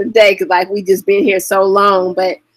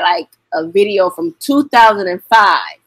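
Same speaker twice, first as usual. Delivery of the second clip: usual speaking voice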